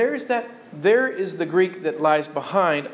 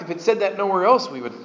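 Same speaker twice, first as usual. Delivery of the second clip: usual speaking voice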